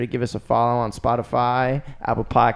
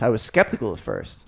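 normal talking voice

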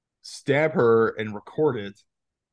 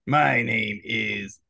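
slow robotic villain voice; drawn-out mock robot voice; much slower than usual. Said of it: "like, a robotic vo-, voice".

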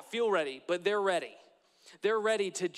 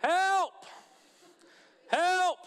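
high, loud mock cries of help; imitating a yell; 10 semitones higher, 6 dB louder, much slower than usual. Grandpa calling out in the ice cream aisle, hammed-up shouts. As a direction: play the grandpa hollering in the aisle. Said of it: "he would just go".

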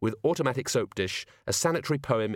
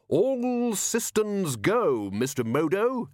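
high, slow call into the tube; Ridcully calling to the engineer through a tube; 9 semitones higher, much slower than usual. Acted. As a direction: shout it down the speaking tube.

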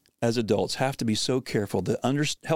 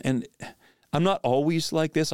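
ordinary talk

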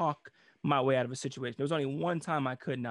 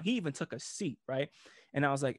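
normal talking voice

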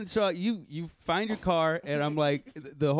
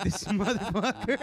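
laughing disbelief; 7 semitones higher than usual. Retold or imitated. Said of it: "And I'm like".

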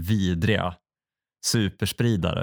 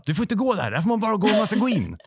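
much higher and faster, imitating a staffer yelling; mock shout; 12 semitones higher, faster than usual. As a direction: rattle it off like an exasperated guard. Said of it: "då skriker de igen".

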